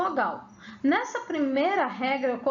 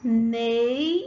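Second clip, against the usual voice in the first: "nei" said alone and punched louder; isolated nei; 2 semitones lower, 4 dB louder than usual. Shown as a demonstration. Teacher isolates the syllable "nei" for pupils. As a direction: hit "nei" on its own. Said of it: "olha como você fala".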